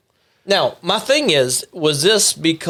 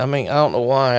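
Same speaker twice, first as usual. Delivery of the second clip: ordinary delivery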